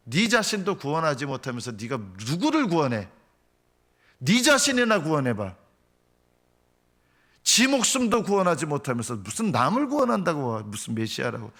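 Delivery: scornful jeer with high-pitched swoops; mocking. Crowd below the cross scoffs at Jesus, mocking. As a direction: taunt hard, punching up the key words. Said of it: "이러면서 밑에서 끊임없이 조롱했던".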